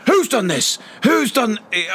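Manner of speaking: a mock West Country teacher demanding who did it; Bristol accent. Teacher demanding answers, in a hammed-up Bristol accent. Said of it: "I can't really do that accent".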